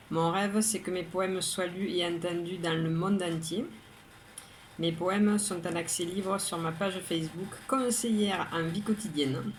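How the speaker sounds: usual speaking voice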